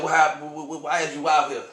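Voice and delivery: high, loud, stammering woman; a woman shouting and stammering in alarm; urgent high shout. Retold or imitated. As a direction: demand it, voice up and loud. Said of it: "she said".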